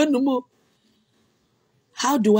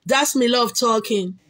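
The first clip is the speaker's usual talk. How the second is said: ordinary talk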